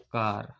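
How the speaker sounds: कार with a long open "aa"; आ sound in कार. Shown as a demonstration. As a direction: hold the आ in कार as "kaa-r". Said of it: "ये एक आ का sound आता है". (कार में लंबी "आ" ध्वनि)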